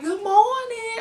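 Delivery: very high-pitched Southern greeting; imitated country accent. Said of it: "when you down there and they be like".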